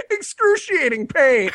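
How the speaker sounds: high, drawn-out cackling mockery; mock audience laughter. Comic imitates laughing theater audience, mock hilarity. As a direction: imitate a crowd howling at someone's pain. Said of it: "everyone in the theater was like".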